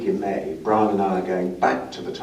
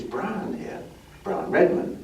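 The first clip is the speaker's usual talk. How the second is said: imitated northern English voice, much higher; northern British accent; 10 semitones higher, slower than usual. Injured driver introduces himself to his wardmate in a high northern British accent. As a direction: pitch it up as a retold northerner. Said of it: "a British northern accent".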